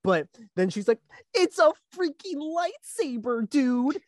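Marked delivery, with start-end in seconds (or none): 1.3-4.0 high, slow put-on girl's voice; hammed-up streamer amazement; mock excited girl. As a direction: stretch it out in a high-pitched gushing imitation. Mimicking the girl reacting on screen.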